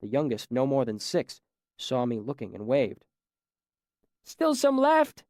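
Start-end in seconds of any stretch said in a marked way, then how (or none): 4.2-5.2 put-on high, slow, childlike call with a mouthful of bread; a small boy calling out between bites; young boy calling. A narrator's reading. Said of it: "he called through a mouthful of bread".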